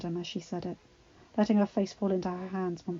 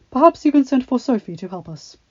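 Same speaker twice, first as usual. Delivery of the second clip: doctor voice; 4 semitones higher, 12 dB louder than usual. A narrator's reading.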